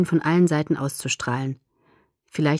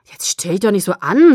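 louder, exasperated push; worked up; 2 semitones higher, 7 dB louder than usual. A narrator's reading. Jacob telling Bella not to fuss, loud and worked up. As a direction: scold her, loud and worked up. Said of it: "sagte er aufgebracht".

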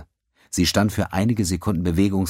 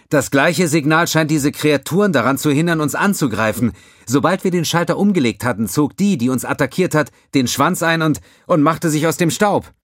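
higher, louder relieved officer; lieutenant voice; 9 semitones higher, 5 dB louder than usual. A narrator's reading. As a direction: report good news with relief. Xiong voiced reporting.

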